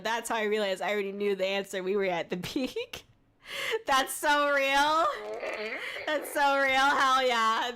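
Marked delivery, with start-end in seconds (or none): 2.5-7.7 louder, higher amused reaction; drawn-out amused reply. Real, not acted. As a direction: react to a bad pun. Reacting to pun.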